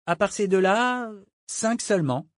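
put-on high-pitched cabin boy; boyish sailor reporting. Cabin boy counting prisoners for the captain, dutiful. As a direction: report promptly in a high young voice.

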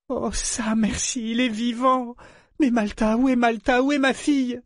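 very high, plaintive wail; moaning mother.

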